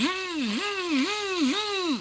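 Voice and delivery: very high buzzing zzz of the fishing line; high reel-buzz imitation; imitated line whizz. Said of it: "That's, that's how he said it".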